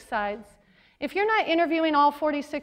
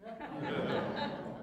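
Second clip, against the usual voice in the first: 1 soft breathy laugh; 3 semitones lower, 11 dB quieter than usual. Real, not acted. Audience-facing laugh.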